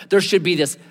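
ordinary talk, nothing marked

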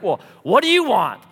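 acted impatient challenge; gruff demand. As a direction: play the mean neighbor confronting a visitor. Mean neighbor demands reason.

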